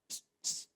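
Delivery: hissed psst to get attention; a secret signal to a friend. Retold or imitated. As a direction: hiss psst under your breath.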